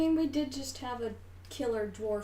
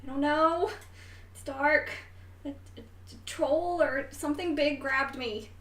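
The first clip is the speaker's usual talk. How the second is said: put-on higher and louder, scared young voice pleading; trapped young voice; 6 semitones higher, 5 dB louder than usual. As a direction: sound small and frightened in the dark. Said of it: "you hear the voice go".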